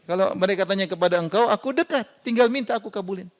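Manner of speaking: usual speaking voice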